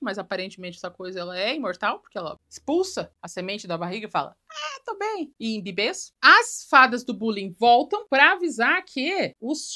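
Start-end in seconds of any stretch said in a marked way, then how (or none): 4.5-5.3 high, soft mock-heroine voice; acted relief. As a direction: lift it high and light, playing the character. Voicing Barbie after expelling the seed.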